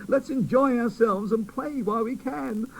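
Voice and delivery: put-on high, bright child voice; boy doll urging the toys to play, high; cheerful little boy doll. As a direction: cheer them up. Quincy cheers fairy.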